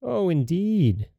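high, slow, scornful dwarf; disdainful. A narrator's reading. Dwarf scorning the queen's offer, slow disdainful voice. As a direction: sneer at the offer. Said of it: "said he disdainfully".

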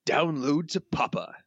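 high, slow cartoon-crook voice; Snake. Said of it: "your best snake voice".

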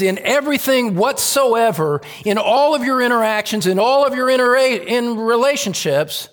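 building to high, insistent preaching; emphatic preaching. Real, not acted. Preacher to congregation on a comprehensive statement, emphatic preaching. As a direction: climb in pitch, hammering each repeated phrase. Preacher drives home the breadth.

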